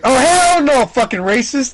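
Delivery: mock-outraged high, loud yell; indignant refusal. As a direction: shout it high, then come down a little. Acting out the teammate's refusal.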